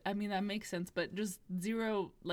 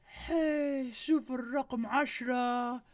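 higher, louder mock street call in Arabic; imitating a catcaller; 4 semitones higher, 5 dB louder than usual. Guys in Libya calling out at the tall woman, higher mock catcall. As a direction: call out across the street like the guys did, fast. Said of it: "by saying".

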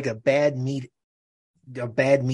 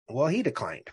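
normal talking voice

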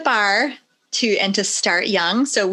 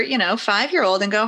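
ordinary talk, nothing marked

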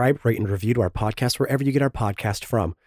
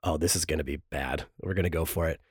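usual speaking voice

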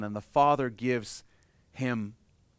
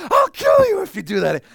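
shrieked furious scolding, much higher and louder; furious parent; 11 dB louder than usual. Pretend. Parent scolds found child, shrieking fury. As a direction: scream the scolding at the lost child. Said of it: "wanna hug them and smack them".

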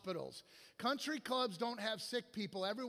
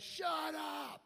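high, stretched-out shout; shouted interruption; 7 semitones higher than usual. Retold or imitated. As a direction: cut the room off with a yell. Angry man stands up, shouts.

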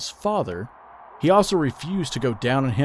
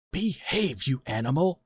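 higher, soft gripe; griping; 4 semitones higher, 4 dB quieter than usual. A narrator's reading. Son scolds his leering father, griping. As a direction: scold him in a high, peeved tone. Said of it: "Eddie griped".

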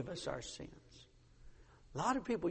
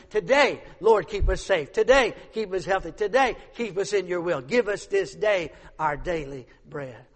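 loud, forceful preaching; 9 dB louder than usual. Acted. Preacher urging daily prayer, loud and forceful. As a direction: press each today home.

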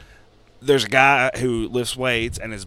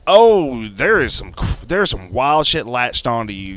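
louder, alarmed warning; 8 dB louder, slower than usual. Retold or imitated. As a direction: warn him urgently about danger. Palm reader warns in story.

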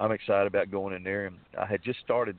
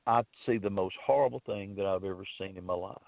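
normal talking voice

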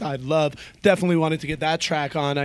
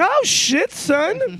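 much higher, loud hype shout; excited exclamation; 4 dB louder, much slower than usual. Retold or imitated. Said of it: "I was like".

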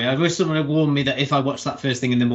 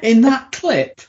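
incredulous; 10 semitones higher, 6 dB louder, slower than usual. Retold or imitated. Retelling Olivia Colman's confused reaction.

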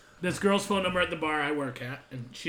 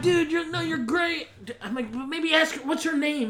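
high put-on coaxing voice; mock consoling; 11 semitones higher than usual. Teller talks the crying guy down, high mock coaxing. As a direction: coax him along, high and light.

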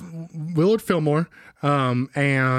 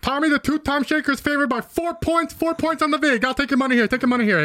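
high, pushy betting barker; bookie announcer; 11 semitones higher than usual. Acted. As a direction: hustle the crowd for bets. Bookie announcer taking bets.

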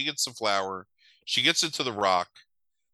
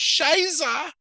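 much higher, comic strangled cry; silly yelp; 4 dB louder than usual. Retold or imitated. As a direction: yelp it like a sketch-comedy goon. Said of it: "like that".

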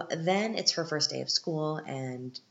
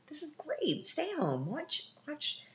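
much higher, giddy exclamation; mock thrilled; 14 semitones higher, slower than usual. Viewer cheers on the dad letting her stay home, giddy. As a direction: gush like you've found your soulmate. I'm like.

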